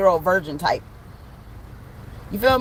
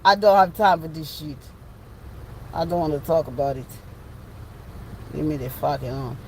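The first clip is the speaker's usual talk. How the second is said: low, soft mock African accent; 5 semitones lower, 5 dB quieter than usual. Shown as a demonstration. Showing off the African voice for viewers, African accent. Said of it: "You love my African voice?".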